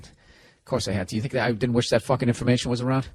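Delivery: ordinary talk, nothing marked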